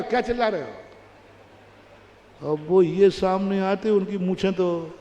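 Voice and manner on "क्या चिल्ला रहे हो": stretched out, slow and quieter; slow, drawn-out old man's rebuke. Acted. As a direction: drag the question out.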